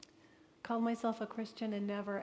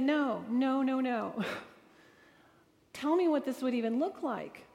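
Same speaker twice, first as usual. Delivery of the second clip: louder, rapid no no no through a laugh; laughing protest; 3 semitones higher, 6 dB louder than usual. Retold or imitated. Telling Randall on the phone she won't accept it: laughing loud protest. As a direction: push back hard but half-laughing.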